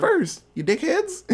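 high-pitched parody of an indignant Tarantino; mock Tarantino rant. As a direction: play him as a whiny, defensive director. Mocking Tarantino's answer to critics.

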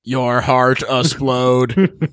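very slow, drawn-out monotone; silly slow-motion creature voice; mock talking heart. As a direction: stretch each word like a doomed heart's countdown. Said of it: "coming out of his chest and be like".

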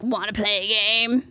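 lightly voiced menacing puppet killer; Jigsaw voice. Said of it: "I thought of Jigsaw".

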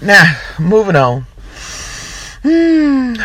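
normal talking voice

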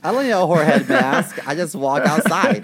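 loud, laughing quip; loud laughing joke. Real, not acted. Co-host jokes back about the mask, loud and laughing. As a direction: toss the line out loud with a laugh.

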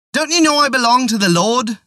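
put-on high, pleading old woman cry. A narrator's reading. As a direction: plead high, calling on the Lord. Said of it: "she cried out loudly".

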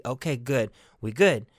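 ordinary talk

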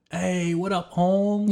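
high, exaggerated Mexican gangster accent. Shown as a demonstration. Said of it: "like, a gangster Mexican".

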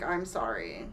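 low, soft, sheepish sorry; mock apology. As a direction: grovel it out half-heartedly. Mocking a meek apology.

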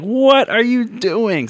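high, slow drawn-out what; mock shocked. To the dog on the bed, high drawn-out mock shock. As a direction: stretch it out in mock disbelief.

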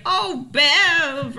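high-pitched gushing over a friend; hammed-up joy at seeing her; mock delighted greeting. Pretending Mildred welcomes Bev's hug, mock delight.

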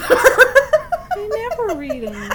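loud, high, slow through laughter; laughing admission. Real, not acted. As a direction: confess while cracking up. Cracking up at own failure.

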